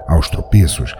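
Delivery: ordinary delivery, nothing marked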